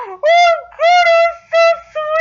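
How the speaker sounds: high, loud, melting squeal; emotional fangirl wail; gushing. Real, not acted. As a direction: squeal it as if overcome by sweetness. Gushing over a tearful scene.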